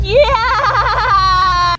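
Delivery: high, very loud drawn-out squeal; crazy voice. Retold or imitated. Hosts mocking an actress's odd voice, crazy high squeal. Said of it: "she's a crazy voice".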